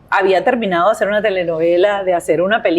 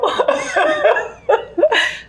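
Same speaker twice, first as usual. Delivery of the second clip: high burst of laughter; 13 semitones higher than usual. Real, not acted. Remembering microteatro audiences, high laugh.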